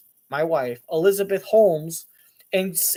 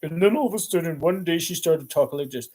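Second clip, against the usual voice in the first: Elizabeth Holmes. Retold or imitated. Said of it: "she kinda talked like this".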